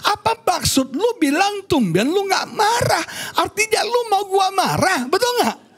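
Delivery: high-pitched acted indignation; mock indignant challenge. Husband answers wife's provoking remark, mock indignant. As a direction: act out a husband rising to the bait.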